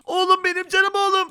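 high, emotional reunion cry; mock-tearful high endearment; hammed-up fatherly joy. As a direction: gush over your found child, playing it up. Father finds his son.

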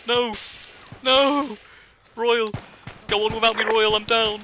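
high, loud dramatic cry; panicked shout. Real, not acted. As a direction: yell it as you go down. Player goes down under fire.

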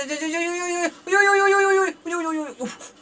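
nervous fear. Real, not acted. Gamer admitting he is a bit scared, nervous high squeal. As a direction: squeal anxiously, then fade into a soft puff. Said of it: "थोड़ा थोड़ा डर लग रहा है".

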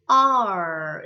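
R said long and loud, tongue curled back; rolled-back English R. Shown as a demonstration. Teacher showing students how the sound rolls, drawn-out R. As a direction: stretch R into a full English r. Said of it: "이렇게 굴려주고 나면".

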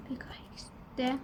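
whispered and slow. Real, not acted. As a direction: whisper it so no one hears the code. Reads code secretly. (kodu fısıldayarak, yavaşça)

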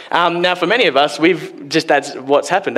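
ordinary talk, nothing marked

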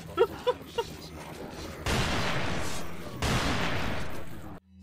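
long airy wheezing laugh; breathy laugh. Real, not acted. Laughing at own hopelessness.

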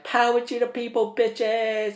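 high, loud gleeful yell; reliving the rampage with a loud whooping yell; mock battle cry. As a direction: shout it like a rebel rallying troops. Shouting while spraying extinguisher.

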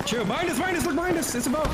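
high excited warning; urgent callout. Real, not acted. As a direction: call out the enemy behind you. Enemy behind, urgent callout.

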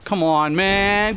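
normal talking voice

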